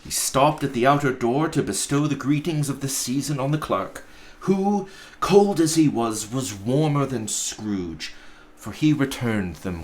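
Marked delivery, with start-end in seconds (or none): none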